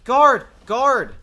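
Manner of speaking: loud desperate call. Acted. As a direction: shout for the guard. Calling out for the guard.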